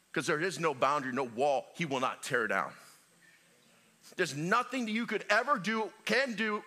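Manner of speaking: ordinary talk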